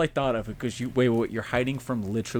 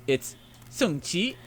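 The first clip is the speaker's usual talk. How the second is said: put-on Mandarin accent; 7 semitones higher, much slower than usual. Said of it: "with, like, a Mandarin accent".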